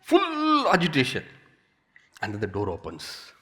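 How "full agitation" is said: much higher, agitated cry; full agitation. Shown as a demonstration. Describing craving mind, high agitated cry. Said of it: "names agitation".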